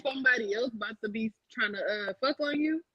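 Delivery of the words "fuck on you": usual speaking voice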